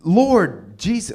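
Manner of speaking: slow, loud panicked cry for the Lord; mock scream. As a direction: call out to Jesus in mock panic. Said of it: "and they're screaming".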